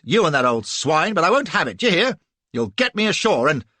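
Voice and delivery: loud, furious threats; angry tirade. A narrator's reading. Flashman threatening the captain with hanging, loud angry tirade. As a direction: rage and threaten him.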